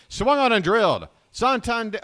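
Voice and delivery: high, loud burst of play-by-play excitement; real excitement. Real, not acted. Calling Santander's swing too soon, real excited shout. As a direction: shout the call as if it's a home run. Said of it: "unnecessary excitement".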